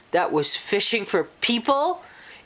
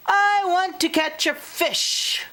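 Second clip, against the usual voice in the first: put-on much higher, insistent little girl; impatient girl; 11 semitones higher than usual. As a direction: whine it like a restless kid. Cheryl whines to her dad.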